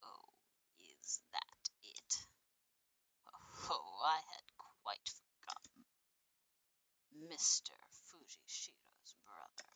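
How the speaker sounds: low whispery villain. Acted.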